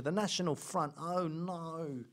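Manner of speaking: feigned dread, softening on "oh no"; drawn-out mock worry; mock alarm. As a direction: pretend to be worried, sarcastically. Mocking fear of a dead group.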